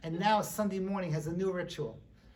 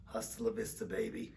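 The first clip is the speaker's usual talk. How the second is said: very low, soft deadpan send-off; action-hero farewell; 9 semitones lower, 7 dB quieter than usual. Acted. Daddy shoves his daughter downhill, mock tough-guy farewell. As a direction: drop the voice right down.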